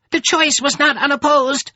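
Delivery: very high, loud creature cry. A narrator's reading. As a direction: cry out in protest as a crowd of creatures. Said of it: "voices cried".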